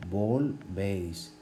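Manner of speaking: volvéis said very slowly, stress on véis; teacher drawing out volvéis; slow demo word. Shown as a demonstration. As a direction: stretch volvéis for the class. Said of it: "lleva tilde".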